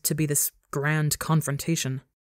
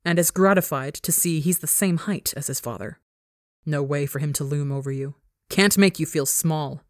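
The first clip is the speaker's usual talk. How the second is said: usual speaking voice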